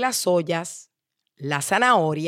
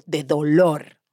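plain reading voice

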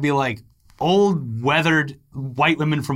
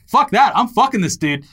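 louder, higher, brash; defiant outburst; 6 semitones higher, 4 dB louder than usual. Retold or imitated. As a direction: shout it back defiantly. Defiant girls voiced.

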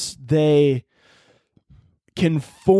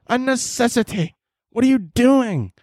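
pitched-up exasperated lecture to a friend; exasperated scolding; 9 semitones higher than usual. Pretend. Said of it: "I'm gonna be like".

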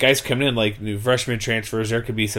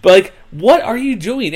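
exasperated; 10 semitones higher, 7 dB louder than usual. Real, not acted. Exasperated at coach's comparison.

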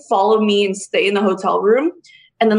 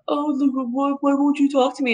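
higher, softer imitation of a pleading ex; lightly mocking, small pitched-up plea; 4 semitones higher, 4 dB quieter than usual. Ex calls her later, mimicked as softly pleading.

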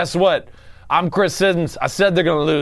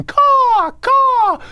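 a much higher, louder crow-like caw; mock eagle caw; 4 dB louder than usual. Cheering the Eagles with a much higher eagle caw. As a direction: squawk like an eagle, high and loud.

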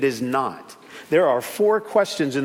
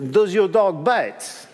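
put-on French accent; slower than usual. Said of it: "He has a French accent".

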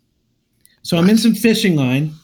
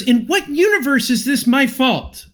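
higher, indignant outrage; angry swimmer confronting the fisherman, higher and indignant; retold indignation; 6 semitones higher than usual. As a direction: throw the question at him in disbelief. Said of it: "And I was so angry".

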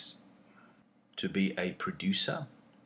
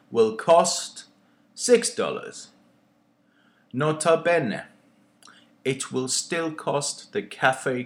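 performed poem with mounting ire; poem reading; 5 semitones higher, 6 dB louder than usual. Acted. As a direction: read it like a rising protest, building indignation. Reads his own poem aloud.

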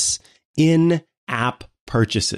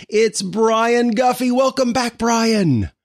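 excited welcome; 10 semitones higher, slower than usual. Real, not acted. Host welcoming guest enthusiastically.

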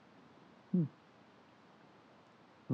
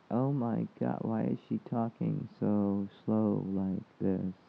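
mock slow talk; slower than usual. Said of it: "I'm like".

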